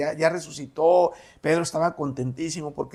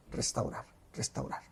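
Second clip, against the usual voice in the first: fading repetition; 12 dB quieter than usual. Real, not acted. Preacher repeats the word, fading.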